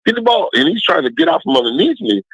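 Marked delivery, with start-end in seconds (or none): 0.1-0.5 a mock yell pitched well up; retold yelling. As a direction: call it out high and insistent. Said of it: "I'm yelling".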